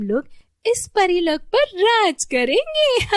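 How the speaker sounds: high, loud wicked fairy voice; hammed-up villainess scheming with glee; gloating villain fairy. Villain fairy gloats over plan.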